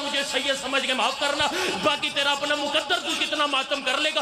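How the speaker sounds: high, building mourning recitation; climactic majlis lament. Acted. Reciter driving the mourners toward the climax, high and keening.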